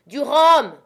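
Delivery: high, loud desperate demand; captain to Jim after the fight, desperate shout; urgent cry. Acted. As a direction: cry out for rum, shaken. Wounded captain demanding rum.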